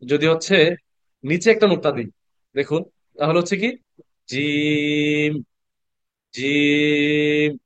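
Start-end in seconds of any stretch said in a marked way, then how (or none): 4.3-7.5 জিম held very long, the vowel stretched slowly, almost sung; long drawn-out jim. Shown as a demonstration. Quran teacher to online students, chanting জিম very long and slow. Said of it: "এটা কিন্তু আমি একটু লম্বা করে পড়লাম".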